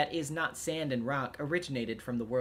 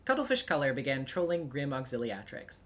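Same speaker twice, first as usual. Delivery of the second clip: ordinary talk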